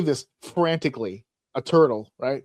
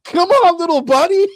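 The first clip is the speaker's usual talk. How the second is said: higher, much louder encouraging shout; root for the turtle at the top of your voice; filming guy cheering on the turtle; 12 semitones higher, 9 dB louder than usual. Retold or imitated. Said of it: "he's like".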